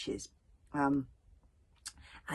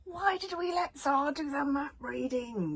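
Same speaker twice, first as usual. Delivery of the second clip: slow, higher wail of dismay; 11 semitones higher than usual. Acted. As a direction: whine it out in despair. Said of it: "wailed Bodkin".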